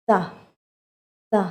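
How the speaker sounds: "the" with tongue touching the front teeth, puffing air; airy TH sound. Shown as a demonstration. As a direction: blow air out on each "the". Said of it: "เอาลิ้นแตะที่ฟันหน้า แล้วก็พร้อม". (ออกเสียง the เอาลิ้นแตะฟันหน้าพร้อมพ่นลม)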